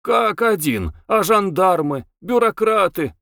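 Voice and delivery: high-pitched mild exclamation; restrained cry of protest; narrated outcry. A narrator's reading. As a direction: object as if calling out, without real shouting. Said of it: "закричали".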